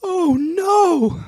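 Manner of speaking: very high, nasal-free squeaky "Oh, no"; high whiny impression; Trace's whiny voice. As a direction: squeak it out like a whining old worker. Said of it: "This is actually how he sounded".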